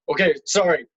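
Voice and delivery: mock apologetic paramedics. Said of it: "they're like".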